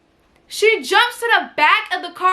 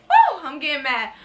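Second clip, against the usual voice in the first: normal talking voice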